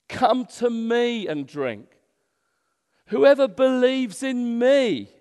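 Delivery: loud, high, drawn-out call. Acted. Preacher voicing Jesus' invitation to the thirsty, loud voice. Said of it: "said in a loud voice".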